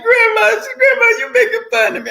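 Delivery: put-on high, fast, hurt young woman's voice; granddaughter's whine. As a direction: squeak it out like a wounded grandkid protesting.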